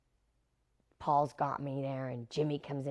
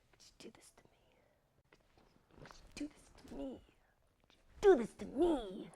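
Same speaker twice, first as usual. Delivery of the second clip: from barely audible whisper to high, pleading voice; intense whisper building; 8 semitones higher, 4 dB quieter than usual. Retold or imitated. Method actor working himself up, whisper rising to voiced plea. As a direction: repeat it, each time more intense.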